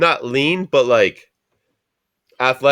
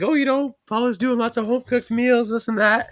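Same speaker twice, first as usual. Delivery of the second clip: high-pitched imitation of mom; mom's voice; 10 semitones higher than usual. Son mimicking his mom about home-cooked meals, high voice. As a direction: lift it well up into a put-on motherly voice. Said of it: "She's like".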